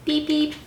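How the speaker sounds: high little horn beeps; truck horn beep. Acted. Showing children the sound Blue makes, high beeps. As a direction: imitate a small truck's horn. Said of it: "that's the sound he makes".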